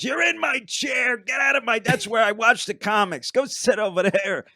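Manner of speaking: imitated club owner shouting, high and loud; angry scolding. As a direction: order someone out of your seat, loudly. Club owner orders nervous comic away.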